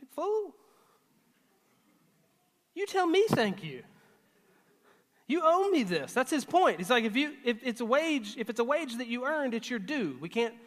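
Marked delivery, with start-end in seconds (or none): 0.1-6.1 higher, incredulous retort; indignant. Retold or imitated. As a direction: scoff at the boss who holds back the pay. Indignant worker demanding his wage.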